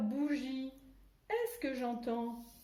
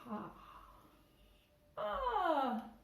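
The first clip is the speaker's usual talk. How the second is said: "lunettes" found with a high, pleased exclamation; mock surprise; 6 semitones higher than usual. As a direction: pretend to discover the sunglasses, delighted. (surprise enjouée pour les enfants)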